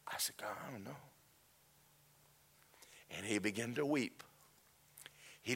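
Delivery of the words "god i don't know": whispered, helpless answer; very soft whisper; hushed reply. Retold or imitated. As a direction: let it out under your breath. Answering God in the hush.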